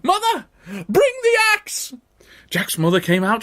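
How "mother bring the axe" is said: very high, loud calling; urgent shout. A narrator's reading. Jack calls his mother for the axe, urgent shouting. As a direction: yell for help at a high pitch. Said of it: "he shouted".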